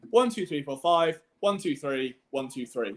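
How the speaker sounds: "One, two, three, four, five, one, two, three" grouped five then three then three; number cadence. Shown as a demonstration. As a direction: read the digits in a five-three-three rhythm. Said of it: "what would you say?".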